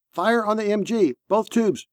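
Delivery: high, tense command; urgent firing order. A narrator's reading. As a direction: give the gunner a combat order. Commander orders gunner to fire in battle.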